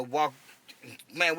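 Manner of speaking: ordinary delivery, nothing marked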